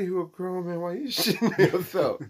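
high, laughing through the line; laughing quote. Retold or imitated. Retelling the skit again to a friend, laughing high. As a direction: laugh while yelling it high. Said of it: "He said".

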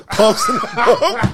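slow, laughing through the words. Shown as a demonstration. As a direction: laugh it out slowly.

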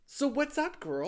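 high, loud squealed greeting; mock perky greeting. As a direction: chirp it high and loud like a show opener. Mock greeting to restart show.

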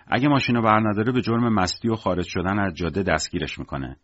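ordinary delivery

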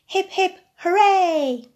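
loud, high joyful cheer. Acted. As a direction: cheer it like a crowd. Cheering the happy ending.

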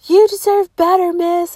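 high, loud mock-earnest guard voice; hammed-up security guard.

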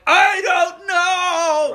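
high, loud, slow hammed-up wail; drawn-out wail. Boy to his mom, drawn-out pitiful wail. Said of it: "I'm like".

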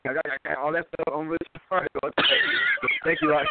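hollow, echoing phone audio; like talking in a big hall. Real, not acted. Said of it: "sorry for the, uh, echo".

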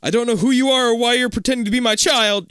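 high, loud angry father. Acted. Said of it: "your father says angrily".

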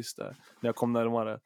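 ordinary talk

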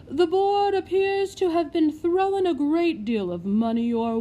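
loud, high, pompous official; stern lord. Acted. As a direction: lay down the law like a stern lord. Lord confronts matron on business.

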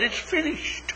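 high, soft whisper. A narrator's reading. The grass whispering to the flowers, high and soft. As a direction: breathe it on softly. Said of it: "the grass whispers to the flowers".

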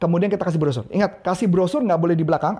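normal talking voice

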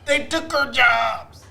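high, slow hammed-up shout; mock they took our jobs. Mock anti-immigrant shout, slowed.

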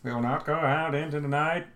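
put-on movie president's speech in an American accent. Co-host redoing the Independence Day speech, in an American accent. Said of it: "when he's American".

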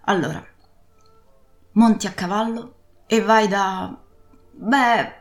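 loud, pressing sea captain giving orders; urgent old pirate. Acted. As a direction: send the boy off urgently. Captain urges Jim to ride.

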